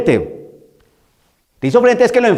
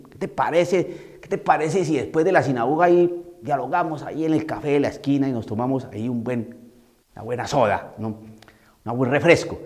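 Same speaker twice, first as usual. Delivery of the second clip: soft, lower, coaxing tone; mock timid invitation; 6 semitones lower, 8 dB quieter than usual. Timid priest invites the demon for a soda, soft coaxing. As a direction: sweet-talk the demon into a coffee. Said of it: "Estoy caricaturizando".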